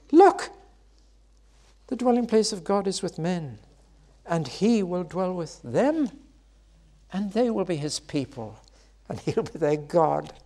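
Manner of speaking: a high, loud "Look!" then a high, awed proclamation; an angel overwhelmed by the wonder, announcing it; astonished cry. Acted. Angel proclaiming God dwells with men, high and awestruck. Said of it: "the angel cries out".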